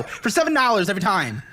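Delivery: ordinary talk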